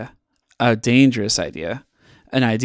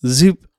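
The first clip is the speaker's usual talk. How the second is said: quick rising zoop; a cartoon machine noise; zoop sound; 4 semitones higher, 4 dB louder than usual. A narrator's reading. The Replicator three thousand copies Alpaca with a zoop.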